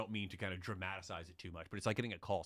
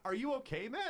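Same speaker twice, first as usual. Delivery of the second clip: lightly voiced concerned guard, pitched much higher; caring security guard. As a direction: ask gently like a worried friend. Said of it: "and he's like".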